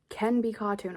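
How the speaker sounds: usual speaking voice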